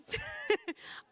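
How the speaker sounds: short high laugh; bright laugh. Real, not acted. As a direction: laugh out, delighted. Laughs mid list of activities.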